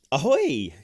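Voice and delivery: a light pirate greeting called out; lightly voiced pirate hail; pirate ahoy like Marine. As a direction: toss off a cheery pirate ahoy. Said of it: "I can't help but ahoy like, um, like Marine can".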